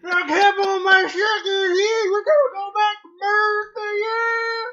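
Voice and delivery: shouted, very high hillbilly holler; hammed-up hillbilly yell, stretched high. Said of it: "redneck hillbilly".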